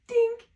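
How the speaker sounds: high sharp dink of a dud gun; comic dud-gun noise; dink sound. Retold or imitated. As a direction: squeak out a tiny failed shot. Gun fails sound effect.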